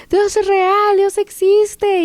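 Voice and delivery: high-pitched joyful proclamation, mildly shouted; grito gozoso. Retold or imitated. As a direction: call it out high, stretching the last word. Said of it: "empiezo a gritar". (grito de júbilo contenido, agudo)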